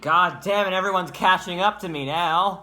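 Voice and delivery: high, loud, hammed-up exasperation; exasperated mock outburst. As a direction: throw up your hands and yell it. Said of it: "It's like".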